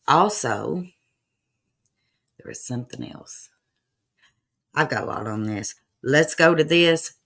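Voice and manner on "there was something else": hushed whisper, dropping almost to nothing. Real, not acted. Tipping off viewers in a hushed whisper. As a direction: lean in and whisper it like a secret. Conspiratorial hint to stream viewers.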